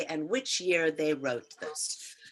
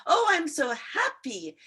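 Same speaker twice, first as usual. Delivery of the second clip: high, loud happy exclamation; upward intonation; 8 semitones higher, 5 dB louder than usual. Shown as a demonstration. Teacher showing intonation to class, bright rising exclamation. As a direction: sing it upward with joy. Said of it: "That is an upward intonation".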